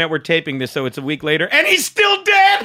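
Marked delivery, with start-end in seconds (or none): 1.5-2.7 high, slow, hammed-up reveal; mock dramatic. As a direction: stretch it out for the joke. Dark joke delivered.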